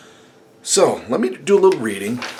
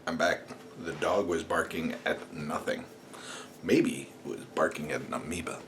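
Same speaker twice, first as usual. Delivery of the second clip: ordinary talk, nothing marked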